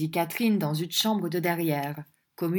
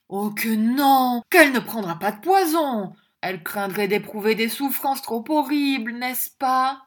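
wheedling dandy husband; 5 semitones higher, 5 dB louder than usual. Acted. Mantalini soothing wife.

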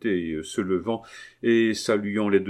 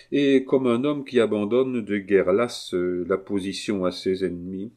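usual speaking voice

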